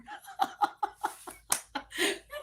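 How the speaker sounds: a long, rising peal of laughter; high laugh. Real, not acted. Laughing fondly at her late son's joke. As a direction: let the laugh run up high. After son's joking text.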